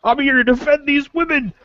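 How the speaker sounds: menacing yell. Acted. Guard yells at Jacob to defend the women, menacing. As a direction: bark it at him like a protective bouncer. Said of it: "yells at you and points at you, menacingly".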